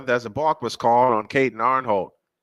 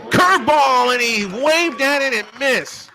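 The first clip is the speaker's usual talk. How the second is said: high, loud play-by-play burst; excited strikeout call; 11 semitones higher, 5 dB louder than usual. Real, not acted. Sportscaster calls a swing and miss, excited. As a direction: raise pitch and volume as the batter misses.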